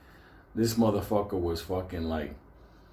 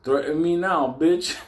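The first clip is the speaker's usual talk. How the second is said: mock threat; 7 semitones higher, 5 dB louder than usual. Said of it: "and he says".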